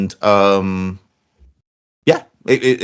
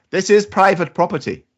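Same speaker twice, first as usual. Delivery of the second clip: put-on Irish accent; 8 semitones higher than usual. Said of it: "How did it sound?".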